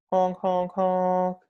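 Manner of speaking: loud high honks like a goose; honking. A narrator's reading. Creature honks offstage.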